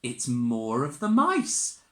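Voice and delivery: usual speaking voice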